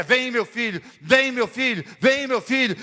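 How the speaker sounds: calls building a little each time; encouraging calls. Acted. Father encouraging son to leap into his arms. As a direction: coax him in, rising each time.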